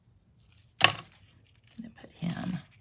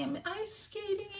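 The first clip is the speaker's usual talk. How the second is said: character voice; 11 semitones higher, 11 dB quieter than usual. Acted. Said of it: "Sammy's ice skating".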